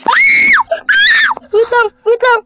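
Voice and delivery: very high shriek into crying pleas; scream, then beg it to stop; terrified scream. Real, not acted. Caught by monster, screaming.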